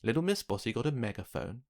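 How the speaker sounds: plain reading voice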